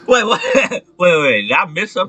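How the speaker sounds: high, loud, laughing disbelief; wait, what just happened; surprised laughing. Real, not acted.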